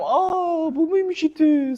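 high, gormless mock question; dumb answer voice. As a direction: play a clueless student. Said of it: "salak salak cevaplar vermeyin".

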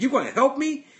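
high, loud disbelieving question; surprised question. Retold or imitated. Worker amazed a stranger offers to help, high surprised question.

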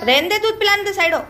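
exasperated mother calling out. Real, not acted. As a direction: shout across the room so she steps away. Telling child to let kitten feed.

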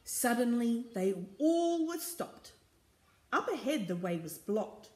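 rhythmic refrain, building suspense; story narration. A narrator's reading. Reader follows the creeping crew to a blockage, story narration. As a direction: read it phrase by phrase with emphasis.

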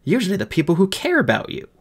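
high, scolding rebuke; snapped. Acted. Fairy blaming the boy for hurting people, snapped. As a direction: lecture the boy sharply, pitch up. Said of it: "snapped the fairy".

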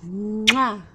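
usual speaking voice

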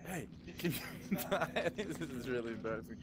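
ordinary talk, nothing marked

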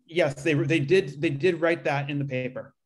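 usual speaking voice

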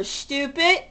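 normal talking voice

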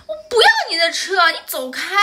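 put-on high, fast, childish snap; mimicking a disgusted little girl. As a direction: play the spiteful little girl pushing him away. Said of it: "表情还很厌恶的". (模仿小女孩厌恶地尖声喊)

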